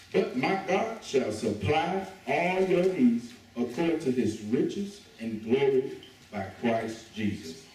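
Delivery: slow, deliberate reading of scripture. Acted. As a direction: lay each word down slowly for the church. Reads scripture.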